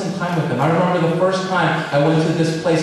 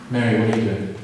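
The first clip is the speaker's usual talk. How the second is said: lower, exasperated scolding, a touch of real irritation; mock annoyed older sister; 7 semitones lower, 7 dB quieter, much faster than usual. Martha scolding Mary for not helping, lower and exasperated. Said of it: "Martha got mad".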